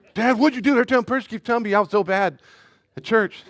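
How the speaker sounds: put-on high, loud whiny child complaining; whiny kid voice. A dad voicing his son whining about church. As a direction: pitch it up like a sulky kid.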